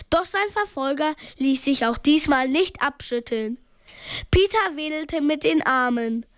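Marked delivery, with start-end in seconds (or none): none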